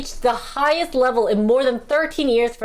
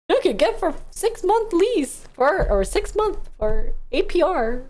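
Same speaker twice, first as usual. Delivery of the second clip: car ad voice; 7 semitones higher than usual. Retold or imitated. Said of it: "car commercial".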